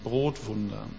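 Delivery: Brotwunder viel leiser und tiefer nachgesetzt; quiet echo. Shown as a demonstration. Keyword echoed softly. (Brotwunder leise und tief nachhallend)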